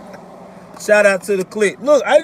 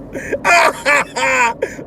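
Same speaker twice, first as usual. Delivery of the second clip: big laugh. Real, not acted. Driver joking about driving like Grandpa, hearty cackle. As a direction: crack up at your own joke. Cracking up at own grandpa joke.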